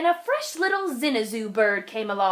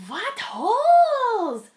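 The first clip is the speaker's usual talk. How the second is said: plain reading voice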